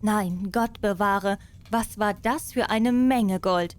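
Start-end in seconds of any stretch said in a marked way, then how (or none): none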